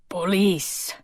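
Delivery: lightly voiced thick Greek accent, hoarse smoker. A narrator's reading. Portly island policeman announcing himself at the door, thick Greek accent. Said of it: "with a thick accent and the hoarse voice".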